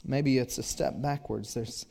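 ordinary delivery

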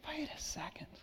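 breathy suspicious whisper; whispered double take. Retold or imitated. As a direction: hush it like a doubting aside. Listener whispers doubt.

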